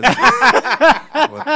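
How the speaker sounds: big high-pitched laugh; real, uproarious cackle at a crude joke; loud burst of laughter. Real, not acted.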